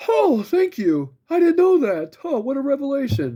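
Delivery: high, exaggerated sarcastic amazement; over-the-top fake delight at being told the obvious; mock gratitude. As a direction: gush sarcastically, stretching the words out. Mocking a pointless store greeting.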